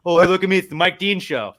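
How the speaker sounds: high, loud, laughing mockery of a show-off referee; sneering impression of a referee who loves the spotlight; mocking Mike Dean. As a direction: ham it up as the vain ref. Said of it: "fuck, Mike Dean".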